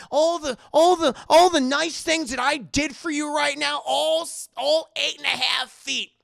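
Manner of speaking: high, loud indignant rant; acted aggrieved tirade; outraged Howard. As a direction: sputter in offence, tripping over repeated words. Acted host rants at guests.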